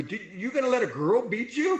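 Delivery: high, quick, loud taunt; goading coach. Retold or imitated. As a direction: challenge them in mock disbelief, rising high. Coach goading him in the story.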